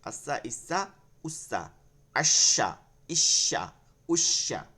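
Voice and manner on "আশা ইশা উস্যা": loud drill syllables. Shown as a demonstration. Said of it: "এইভাবে করে করে প্রতিটা".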